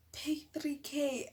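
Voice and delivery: slow, high disbelief; slow stunned echo; incredulous. Retold or imitated. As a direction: repeat the price slowly in shock. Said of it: "And I'm like".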